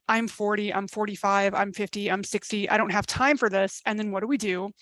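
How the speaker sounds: ordinary delivery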